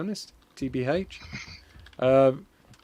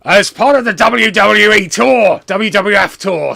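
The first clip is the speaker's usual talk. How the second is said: loud wrestling-promo bellow; big, raised, shouting wrestler voice; Mister McMania; 5 semitones higher, 10 dB louder than usual. Retold or imitated. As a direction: boom it out like a ranting wrestler. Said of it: "what's he sound like?".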